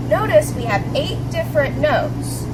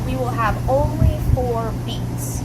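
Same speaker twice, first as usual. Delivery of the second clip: whisper. Real, not acted. Whispered line.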